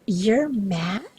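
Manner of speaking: "You're mad?" with a swooping rise of disbelief; incredulous question. Shown as a demonstration. Instructor demonstrating intonation to interpreters, disbelieving rise. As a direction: ask "You're mad?" as if you can't believe it. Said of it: "I just said that in four different ways".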